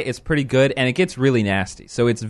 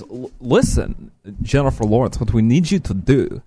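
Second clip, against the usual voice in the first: bad Russian accent; 2 semitones lower than usual. Uncle recruiting Jennifer Lawrence as spy, in a clumsy mock Russian accent. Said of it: "that went all over the place".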